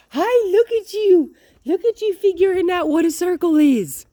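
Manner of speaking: very high, loud praise for a dog; real excited. Shown as a demonstration. Trainer praising a circling dog, real excited. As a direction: squeal it out, thrilled with the dog. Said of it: "Real excited, right?".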